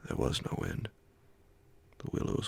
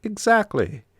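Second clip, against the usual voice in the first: much higher, louder foreign companion voice; the Swede; 12 dB louder than usual. A narrator's reading. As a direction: give the Swede a high, loud, strained voice.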